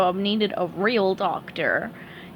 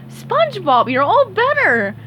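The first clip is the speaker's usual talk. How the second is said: high, louder happy squirrel; cheerful greeting; Sandy voice; 9 semitones higher, 6 dB louder than usual. Acted. Sandy greets the cured SpongeBob, high cheerful.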